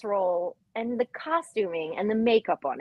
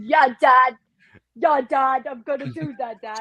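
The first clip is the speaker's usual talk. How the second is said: Christopher Walken impression; 4 dB louder than usual. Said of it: "bad Christopher Walken impression while also trying to sound like a finance bro".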